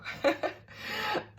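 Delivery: high airy giggle; high breathy laugh. Real, not acted. Reader giggling at her own remark to viewers. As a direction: giggle it off lightly.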